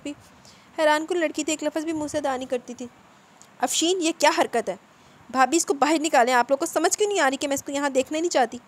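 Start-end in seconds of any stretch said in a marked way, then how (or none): 3.6-8.6 loud, raised-pitch outburst of outrage; shouting. A narrator's reading. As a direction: narrator acting out an angry yell. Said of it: "Afsheen चिल्लाई". (चिल्लाकर गुस्सा जताते हुए)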